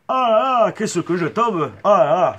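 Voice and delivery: loud, hammed-up wail of falling. Shown as a demonstration. Actor redoing his fake fall, with exaggerated gusto. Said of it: "un peu plus d'entrain".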